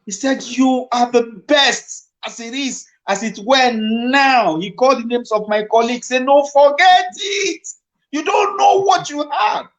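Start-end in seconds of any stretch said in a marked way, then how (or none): 0.4-4.6 slow, higher plea, mildly tearful; a touch of tears in a slow, higher voice; retold tearful plea. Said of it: "He was talking to me in tears". 6.2-9.7 much higher, crying shout; the director breaking down in tears as he shouts. Retold or imitated. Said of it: "Oh, he was crying".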